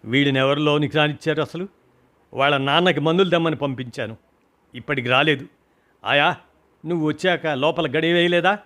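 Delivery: ordinary delivery, nothing marked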